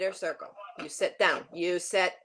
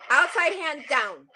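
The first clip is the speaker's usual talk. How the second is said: loud, higher command; sharp shout; 5 semitones higher, 9 dB louder than usual. Real, not acted. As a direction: bark the cue across the arena. Coach calls sharply.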